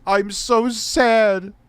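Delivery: high, drawn-out fake lament; mock sad. Parodying men rejected by women, mock sad. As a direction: stretch it into a hammed-up wail.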